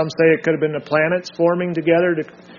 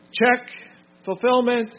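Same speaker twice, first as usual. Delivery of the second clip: calling out points; 5 semitones higher, slower than usual. Real, not acted. Calls out check points to group.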